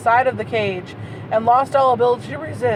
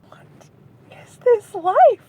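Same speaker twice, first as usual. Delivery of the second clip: higher, slow, incredulous; baffled; 10 semitones higher, 4 dB louder, slower than usual. Real, not acted. Reader baffled by the raptor romance, slow high disbelief.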